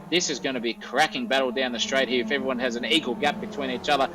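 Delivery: normal talking voice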